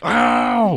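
high shrieked yelp of pain; mock yelp. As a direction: yelp as if struck. Voicing the hit man's cry.

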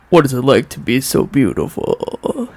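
gross, deep creepy killer voice; Buffalo Bill. Acted. Imitating a TV killer's warped voice, gross and deep. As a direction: drop low and make it gross. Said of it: "like, really gross and deep".

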